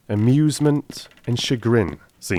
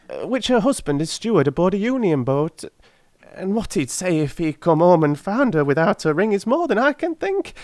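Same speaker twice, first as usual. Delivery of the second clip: put-on high, pleading old crone voice; a poor old charwoman begging humbly; old woman; 11 semitones higher, 4 dB louder than usual. Old woman begs the gentlemen for the ring, high and humble.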